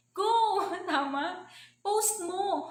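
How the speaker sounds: excited cheer. Acted. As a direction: cheer the uplines on with a sudden high shout. Urging uplines to post downlines. (masiglang sigaw ng pagpapalakas-loob)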